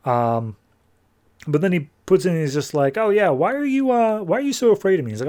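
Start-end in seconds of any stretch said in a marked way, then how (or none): 3.0-5.1 high-pitched teasing question; mock needling. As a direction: needle him in a raised voice. Zod needles Superman about fear.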